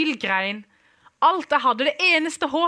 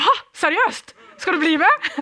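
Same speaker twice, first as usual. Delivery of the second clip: surprised; 6 semitones higher, 4 dB louder than usual. Retold or imitated. Girl stunned he says yes.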